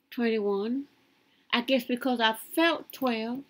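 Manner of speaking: usual speaking voice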